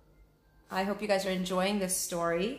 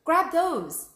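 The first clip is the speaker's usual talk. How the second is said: higher, louder urgent order; urgent call; 10 semitones higher, 8 dB louder than usual. Acted. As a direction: call out so she grabs them. Jack urges Annie.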